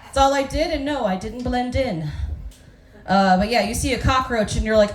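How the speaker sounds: ordinary delivery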